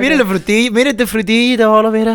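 porteño exagerado, agudo; acento de Buenos Aires. Shown as a demonstration. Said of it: "eso de Buenos Aires tiene que decir". (acento porteño de broma)

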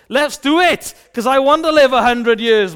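loud, high mock enthusiasm; eager resolve. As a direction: burst out like a kid suddenly keen. Acting child suddenly eager to obey.

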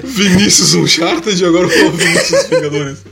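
mock announcer. Teasing friend with mock titles.

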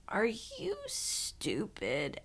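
hushed, drawn-out disbelief; near-whispered aside to yourself; muttering to oneself. Retold or imitated. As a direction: say it slowly under your breath, barely voiced. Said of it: "saying out loud to myself".

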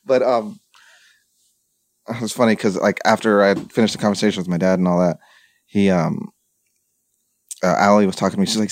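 normal talking voice

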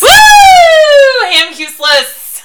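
loud, high whoop; cheering. Real, not acted. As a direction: cheer it like a goal. Cheering after shout.

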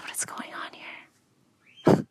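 slow, bewildered whisper; whispered disbelief. Acted. Teenager reacting to PhD's bad news, stunned whisper.

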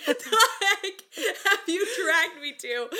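high, drawn-out laughing disbelief; mock horror at the betrayal; laughing disbelief, high and slow; shocked. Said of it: "And I'm like".

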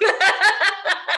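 high laugh into words; giggling. Real, not acted. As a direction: laugh then speak. Laughing at the story.

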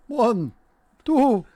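high, loud vampire counting. Pretend. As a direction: count like the Count, rising.

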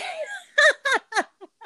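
very high, helpless burst of laughter. Real, not acted. Laughing while trying to say something inspirational about dating, very high.

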